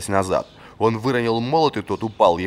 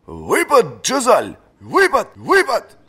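high, loud drill-master bark; barked orders; 12 semitones higher, 5 dB louder, slower than usual. A narrator's reading. Old fencing master barking at his pupil during drill. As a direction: bark each command like a drill. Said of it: "гаркнул лорд-маршал Варус".

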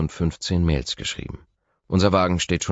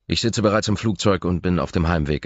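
ordinary delivery, nothing marked

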